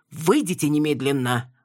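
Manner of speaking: ordinary delivery, nothing marked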